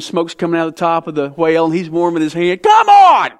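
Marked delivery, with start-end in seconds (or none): 2.6-3.3 sudden high, loud outburst of disbelief; exasperated come on. Real, not acted. Pastor scoffing at cute Jonah pictures, a loud high come on. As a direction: throw it out, much higher and louder, like the pictures are absurd.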